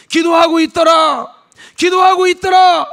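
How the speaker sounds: building shouted refrain; shouted repetition. Shown as a demonstration. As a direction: hammer 기도하고 있더라 home with a shout. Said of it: "repeated phrase building".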